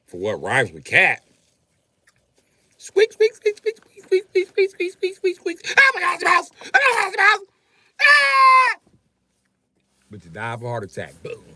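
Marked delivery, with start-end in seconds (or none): 2.8-5.7 high squeaky mouse noises; mouse squeaks. Acted. Imitating a mouse. 5.8-8.8 shrieking high, crying out in mock terror; hammed-up panic. Woman panics at mouse.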